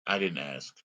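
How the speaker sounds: low, quiet, unbothered; deadpan low dismissal. Retold or imitated. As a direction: brush him off quietly under the breath. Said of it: "But I didn't say that".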